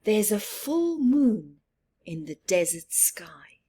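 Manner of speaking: usual speaking voice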